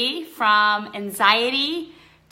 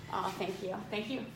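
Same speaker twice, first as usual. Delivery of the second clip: normal talking voice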